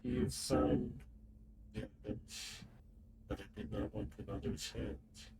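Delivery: hushed, distant voice; mysterious voice. Acted. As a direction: speak like a hidden presence. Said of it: "I think this is the right voice".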